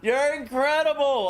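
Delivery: high, bright praise; excited praise. Real, not acted. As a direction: gush at him.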